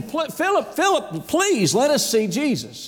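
high, insistent acted plea; begging. Greeks begging Philip for a meeting with Jesus, acted begging. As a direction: keep calling his name, pleading. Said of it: "They, they're begging".

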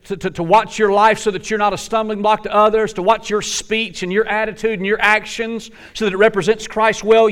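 high, loud questioning preacher; full-throated rhetorical challenge; emphatic challenge. Acted. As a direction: press them hard with the question. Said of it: "He says".

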